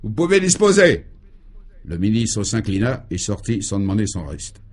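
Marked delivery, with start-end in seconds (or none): 0.0-1.0 sharp, higher and louder dismissal; curt dismissal. Acted. Minister dismissed curtly, sharp dry command. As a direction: snap the order to get out. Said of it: "sèchement prononcés".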